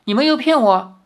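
put-on high, eager child asking; a little boy lighting up with hope; bright wide-eyed child voice; hopeful surprise. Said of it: "那孩童张大了眼".

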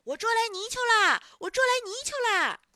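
high, triumphant shout; loud calling out. A narrator's reading. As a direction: holler the news through cupped hands. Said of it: "按在嘴边大声地喊".

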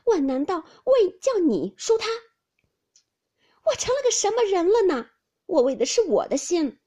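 high, loud, offended protest; indignant spitting retort. A narrator's reading. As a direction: spit it back, deeply affronted. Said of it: "林黛玉啐道".

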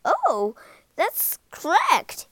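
flustered, high, slow halting reply; teacher stunned by a right answer; shaky surprise. Acted. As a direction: stumble over the words, caught off guard. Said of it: "he said shakily".

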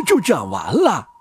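ordinary delivery

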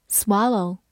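American-accented "swallow"; American pronunciation. Shown as a demonstration. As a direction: open "ah" in the first syllable of "swallow", American-style. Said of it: "美式发音".

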